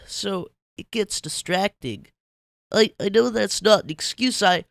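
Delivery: high, loud guilty pleading; guilty SpongeBob. Acted. SpongeBob clinging to Patrick, high guilty pleading. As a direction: pour out a guilty apology. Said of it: "latched on to his companion guiltily".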